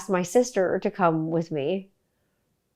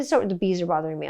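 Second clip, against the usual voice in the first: ordinary talk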